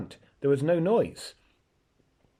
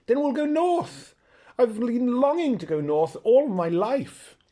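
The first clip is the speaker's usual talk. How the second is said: put-on high, loud, eager boy's voice; a boy bursting with joy at going north; excited boy Shasta; 10 semitones higher, 4 dB louder than usual. Shasta thrilled to head north with the horse, high and eager.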